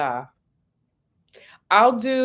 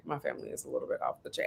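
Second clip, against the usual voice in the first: much lower, softer, fast under-the-breath repeat; low muttered aside; 12 dB quieter, much faster than usual. Shown as a demonstration. A guest repeating it under her breath as an aside. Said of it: "That's all I can say".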